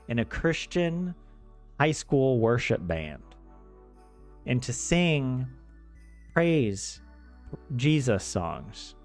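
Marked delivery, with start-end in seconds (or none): none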